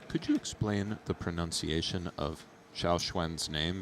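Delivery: usual speaking voice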